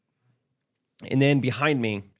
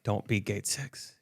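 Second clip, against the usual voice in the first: pleading under breath; 4 semitones lower, 11 dB quieter, slower than usual. Retold or imitated. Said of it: "And I'm like".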